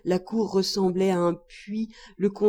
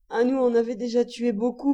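usual speaking voice

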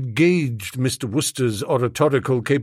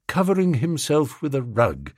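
usual speaking voice

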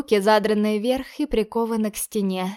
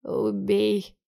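faint hoarse rasp through blood; bloody mouth. Acted. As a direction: croak it, choking on blood. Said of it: "еле слышно хрипит Дерек, захлёбываясь кровью".

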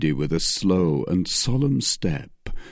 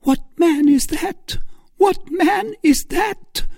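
high, loud panicked woman's voice; Milady suffocated by terror at the stranger; terrified cry; 5 dB louder than usual. A narrator's reading. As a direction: choke out the question in terror. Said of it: "suffocated by terror".

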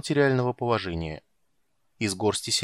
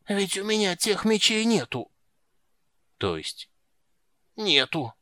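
high gasping clerk; 9 semitones higher than usual. A narrator's reading.

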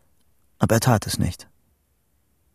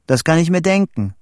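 raised, higher storybook dialogue voice; calling out; 7 semitones higher, 3 dB louder than usual. A narrator's reading. Boy on a tin-can telephone to his friend, calling out. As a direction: call it up the line.

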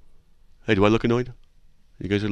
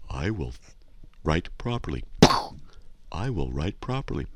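quiet mantra; 4 semitones lower, 6 dB quieter than usual. Shown as a demonstration. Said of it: "And you say".

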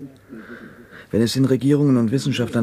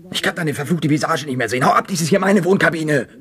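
raised, heated voice, pushing him away; angry outburst; 5 semitones higher than usual. Acted. To Shepard, ordering him out of the cabin, angry and raised. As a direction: snap at him to get out of your cabin.

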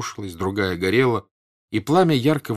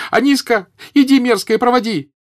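high, loud shriek of command; angry shout; 10 dB louder than usual. A narrator's reading. Mistress orders her servant girl, shouting angrily. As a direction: scold and order in a screech. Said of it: "крикнула она на девку, сердито".

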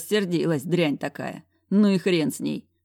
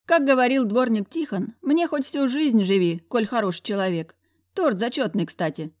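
put-on higher, breezy girlfriend voice; snorting friend; 7 semitones higher than usual. A narrator's reading. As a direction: quote it with a snort. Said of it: "фыркнула фанатка".

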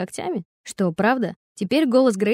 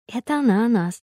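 plain reading voice

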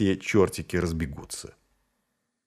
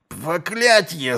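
mild yell; 7 semitones higher, slower than usual. A narrator's reading. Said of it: "заорал Низ".

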